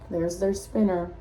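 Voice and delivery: usual speaking voice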